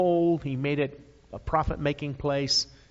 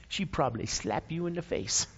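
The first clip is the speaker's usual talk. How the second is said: normal talking voice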